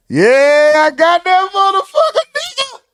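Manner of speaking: high, straining celebratory holler; triumphant yell. Real, not acted. Host celebrating nailing the Duval call, triumphant high yell.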